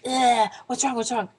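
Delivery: re-enacted flustered questioning; retold shaky panic. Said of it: "my hands are shaking".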